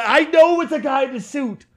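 high, insistent, half-laughing protest; exasperated protest. Real, not acted. Fan admitting it's a guy in a suit, exasperated protest.

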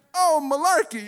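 high prissy fake curse; mock prim curse. Teaching that a stubbed toe needs a real curse, high prissy mock. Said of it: "you can't be like".

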